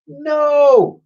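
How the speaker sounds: very high, loud cry; cried. A narrator's reading. Twin crying out as the toys go. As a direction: cry out helplessly. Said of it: "cried Edmund".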